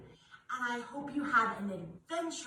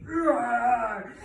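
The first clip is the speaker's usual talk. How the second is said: louder, playful growled roar; playful lion roar; 6 dB louder than usual. Shown as a demonstration. Teacher roars for kids, playful and louder. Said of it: "stomp your lion's feet".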